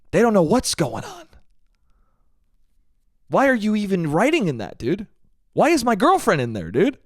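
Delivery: high-pitched, incredulous questioning; exasperated rant. Retold or imitated. Ranting about building group chat writers, exasperated. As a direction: let the pitch leap up and swoop down in disbelief.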